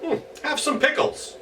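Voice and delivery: high squeaky goblin. Acted. Herschel offers goblin pickles.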